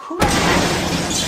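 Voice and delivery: loud, high sound-effect crash. Acted. Heroine's super punch lands, loud and high. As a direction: bang out the impact.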